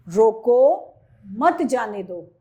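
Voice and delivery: slow, loud रोको, pause, then मत जाने दो; slow example with a comma break after रोको. Shown as a demonstration. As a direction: stop firmly after रोको before मत जाने दो. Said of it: "ये रोको के बाद लगा है".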